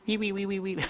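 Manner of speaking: high squeaky dolphin chatter; dolphin squeaks. Shown as a demonstration. The teacher imitates how the dolphin earrings talk, in high squeaks. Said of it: "They talk like this".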